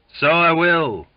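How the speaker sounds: high loud yelp. A narrator's reading. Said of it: "yelped Donkin".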